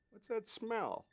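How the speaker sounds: high puzzled crew voice; crew asking. Retold or imitated. Crew on set, puzzled by the smell, high voice. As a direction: mimic the crew sniffing around. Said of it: "everybody's going like".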